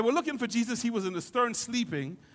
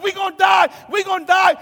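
loud, high panicked cries; frantic shouting, pitched high; panicking; 10 semitones higher, 12 dB louder than usual. Acted. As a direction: yell it like the boat is sinking. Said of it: "some are panicking".

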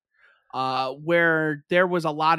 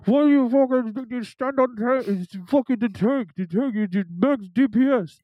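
put-on angry Russian accent through a cheap headset; mocking, muddled Russian gamer tirade; garbled Russian-accented yelling; 5 semitones higher, 5 dB louder than usual. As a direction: rant half-intelligibly in a Russian accent. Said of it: "that's what he sounded like".

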